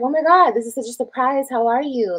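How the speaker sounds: acting out pleased surprise on the phone; surprised greeting. Retelling answering her friend's call, mock delighted. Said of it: "so I was like".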